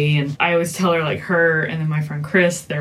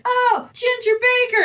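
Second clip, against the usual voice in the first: much higher, eager mock voice; mock Jerry Rubin. Retelling the movie to friends, high eager mock voice. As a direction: blurt the name out like a sudden eureka. Retold Rubin supplying name.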